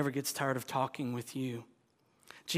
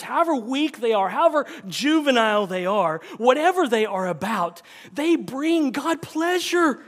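high, loud, fervent sermon; full-force preaching; 9 semitones higher, 9 dB louder than usual. Acted. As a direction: preach it out to the whole room.

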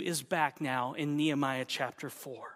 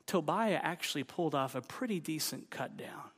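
usual speaking voice

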